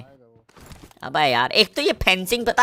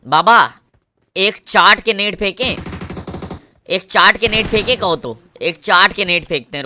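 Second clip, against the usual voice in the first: ordinary talk, nothing marked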